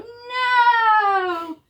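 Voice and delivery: very high, loud chorus shout; loud cry. Shown as a demonstration. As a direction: lead the kids in shouting it. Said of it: "we go".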